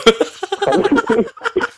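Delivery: long, high-pitched laughter; real high laugh. Real, not acted. Laughing after wordplay. (尖声笑个不停)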